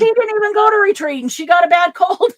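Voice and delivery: high-pitched, loud disbelief breaking into a laugh; can't-believe-it outburst; incredulous exclamation. Real, not acted. Exclaims over friend's bad luck.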